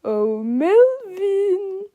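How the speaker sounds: high, slow, drawn-out summons; singsong call. A narrator's reading. Krupp summons his witness at the door, calling in singsong. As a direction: devilishly stretch the name out high. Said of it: "he called".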